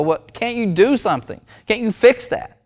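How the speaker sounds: high-pitched, indignant parent; acted incredulous plea. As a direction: demand help in mock disbelief. Acted parent pleading with doctor.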